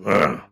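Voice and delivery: low, loud grunt of reluctance; short begrudging growl; grudging grunt. Retold or imitated. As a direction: grumble "ugh" like a reluctant king. King grudgingly gives way.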